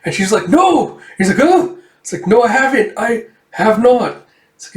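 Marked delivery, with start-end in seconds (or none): 0.5-1.0 high, loud burst of denial; sudden no. Retold or imitated. Amir denying to Karluk she dislikes him, high burst. 1.4-1.8 much higher, loud yelp of surprise; startled oh. Retold or imitated. Karluk startled by Amir's outburst, high yelp. 2.3-4.2 higher, louder, slowing on the last words; flustered denial. Retold or imitated.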